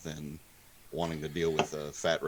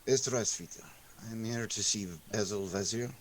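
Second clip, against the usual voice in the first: put-on Russian accent; 3 semitones higher than usual. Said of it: "try and do French in a Russian accent".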